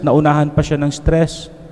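usual speaking voice

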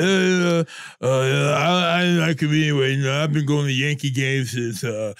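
low, sleepy mumbling radio host; Mike Francesa impression. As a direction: drone it out like a washed-up old radio host half asleep. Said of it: "he's like".

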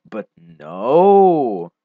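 loud exasperated no; frustrated shout. Real, not acted. Gamer yelling at the lag, loud frustrated shout. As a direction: yell it at the lagging game.

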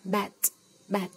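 Bat said with a short flat a. Shown as a demonstration. Teacher reading Bat aloud to learners. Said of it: "Tờ là tờ".

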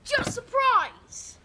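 high, drawn-out excited shout; practice shout. Shown as a demonstration. Child echoing the correction as a surprise, shouting. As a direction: yell it out, stretching "surprise". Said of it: "you shout".

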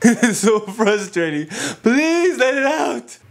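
loud, drawn-out frustrated plea; exasperated pleading. Acted. Listener begging the halting khatib to finish, exasperated. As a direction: beg loudly, stretching the words, pitch climbing.

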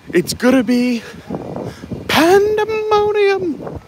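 high, stretched-out squeal of pandemonium; excited. Real, not acted. Hyping the holiday decorations stream to chat, squealing. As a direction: draw the word out way up high, thrilled.

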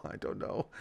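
soft whispered repeat, no voice; whispered I don't know under the breath; whispered aside. Real, not acted. Repeats phrase, second whispered.

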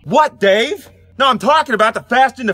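shouting across the yard to Dave; calling out. Acted. Yelling over to neighbor Dave.

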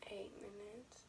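very soft unvoiced whisper; just breathe the answer. Real, not acted. Whispered reply during the challenge.

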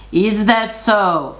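a hint of a doubting troll. A narrator's reading. Troll questions the second goat, slow mild challenge. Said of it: "said the troll".

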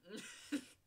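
high squeal of leftover laughter; helpless giggle. Real, not acted. Actor still cracking up, helpless giggle. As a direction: let another real giggle escape.

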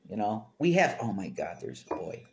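ordinary delivery

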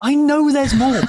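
high and loud, teasing. Acted. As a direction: tease it up high.

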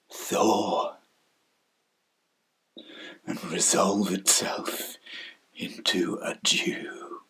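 low, soft, fading to a whisper; hushed despair. Acted. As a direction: let the wish dissolve into breath. Wishing to melt away.

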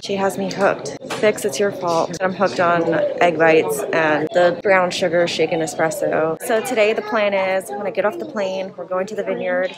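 ordinary delivery

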